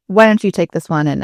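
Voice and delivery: normal talking voice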